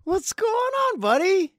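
high and loud, overjoyed; excited greeting. Real, not acted. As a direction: greet your buddy with a big happy shout. Host greeting guest enthusiastically.

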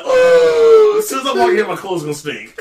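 high, loud disgusted oh; disgusted exclamation. Retold or imitated. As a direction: recoil at the stench. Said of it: "you're like".

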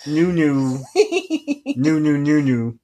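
"new" repeated lightly with a laugh; toying with the word "new" over and over; playful repetition. Shown as a demonstration. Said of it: "so new gnu".

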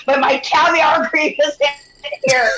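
high, mock-fussy protest; hammed-up indignation; retold pissed-off complaint. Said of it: "she's all pissed off".

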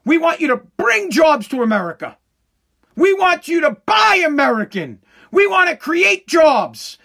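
mock impassioned political plea, stretched out; hammed-up, rising campaign cry; Democrats' plea. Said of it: "this is the Democrats' plea".

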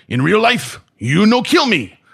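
put-on high, loud, slow Korean-accented broken English; indignant old master's voice with a put-on Korean accent; Jihan Jay. Korean grandmaster refusing to die on film, emphatic Korean accent. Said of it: "Grandmaster Jihan Jay said".

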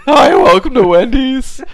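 put-on high cracking teen cashier voice; pimple-voiced teenager. Retelling a TikTok guy greeting Annabelle, in a squeaky teen voice. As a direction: squeak it out like an awkward teen at the register. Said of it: "in that, like, pimple voice teenager".

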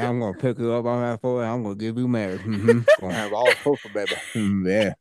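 low, drawling Texas hog hunter; Southern accent. Retold or imitated. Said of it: "What did he sound like? He was from Texas".

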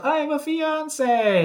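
high, drawn-out mock yell; imitated yell. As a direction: call it out high and slow, imitating her. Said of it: "we said she yelled".

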